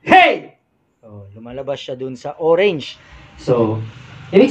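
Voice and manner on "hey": full shout to show the meter peaking. Shown as a demonstration. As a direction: yell hey as hard as you can. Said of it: "Pero kapag sisigaw ako".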